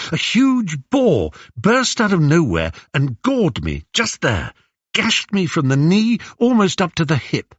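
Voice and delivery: high, animated hunter's tale; Odysseus reminiscing. Acted. Odysseus retelling how a boar gored him, animated. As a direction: tell it with rising excitement.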